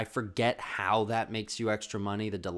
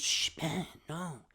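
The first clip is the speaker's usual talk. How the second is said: manipulative brother hushing; 7 semitones higher, slower than usual. Retold or imitated. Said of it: "obviously the master manipulator kid".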